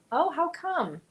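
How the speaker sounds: ordinary talk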